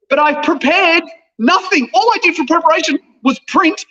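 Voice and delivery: high-pitched, animated protest; exasperated self-defence. Real, not acted. Actor explaining his disastrous audition to appalled co-host, high exasperated protest. Defends himself after co-host's outcry.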